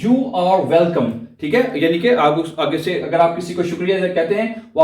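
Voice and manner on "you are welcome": slow, careful model phrase; slow teaching model. Shown as a demonstration. Teacher modelling a phrase for students, slowly. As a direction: spell it out slowly for the learner. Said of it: "अगला देखें जी क्या है?".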